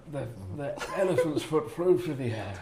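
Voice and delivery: usual speaking voice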